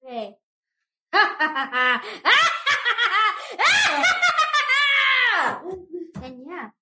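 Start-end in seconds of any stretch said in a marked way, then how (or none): 1.1-6.2 high maniacal cackle, shouted out; villain laugh. Shown as a demonstration. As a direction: cackle like a manic cartoon villain. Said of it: "can I do an evil laugh right now to show you".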